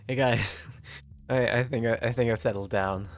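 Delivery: usual speaking voice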